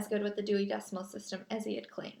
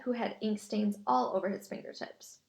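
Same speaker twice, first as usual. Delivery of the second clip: ordinary delivery, nothing marked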